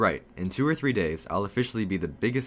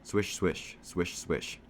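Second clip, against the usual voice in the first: hushed, whispered swish of fabric; soft swishing noise; 4 dB quieter, much slower than usual. A narrator's reading. Narrator mimics phone sound from a pocket, soft swishing. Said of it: "I hear a swish swish sound".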